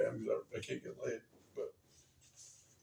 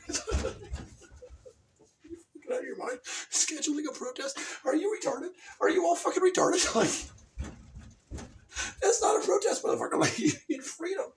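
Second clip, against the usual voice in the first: higher mock outrage; hammed-up angry disbelief; incredulous rant. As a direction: scoff at them in disbelief. Mocks scheduled protest in rant.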